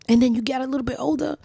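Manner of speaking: ordinary delivery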